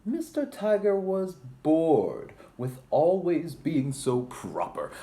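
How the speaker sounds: high, loud storybook opening; dramatic story narration. A narrator's reading. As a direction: read it like a big reveal to kids.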